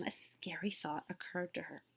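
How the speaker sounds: whispered, hushed and secret; a breathy storytime whisper; spooky whisper. A narrator's reading. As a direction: lean in and whisper it like a scary secret.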